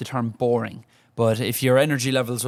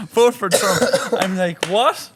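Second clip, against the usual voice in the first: higher, louder, amazed laugh-through; laughing disbelief; 8 semitones higher, 6 dB louder, slower than usual. Retold or imitated. Retelling the bar note to cohost in loud amazed disbelief. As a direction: squeal the disbelief.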